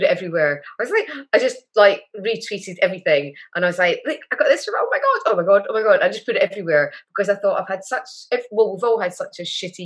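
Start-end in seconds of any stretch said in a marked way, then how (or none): 4.0-6.0 excited squeal. Retold or imitated. Gushing over her bafta news.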